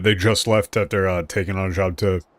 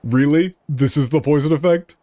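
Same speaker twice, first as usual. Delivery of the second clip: surprised; 6 semitones higher, 3 dB louder than usual. Real, not acted. Surprised reaction to game event.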